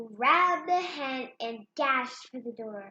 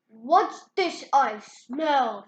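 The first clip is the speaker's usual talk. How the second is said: slow, louder giant growl; 8 dB louder, much slower than usual. Acted. Giant sniffing for Jack, slow booming voice. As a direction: loom in as the giant.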